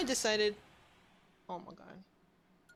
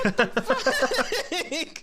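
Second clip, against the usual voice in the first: louder, slow, stunned; stunned disbelief; 3 semitones higher, 6 dB louder, much slower than usual. Real, not acted. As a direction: drag it out in shock. Stunned by what just appeared.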